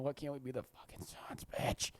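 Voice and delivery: soft, bitter mutter; quiet resentful muttering; muttered curse. Retold or imitated. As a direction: grumble it under the breath. Muttered frustration retold.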